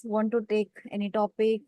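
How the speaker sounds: ordinary talk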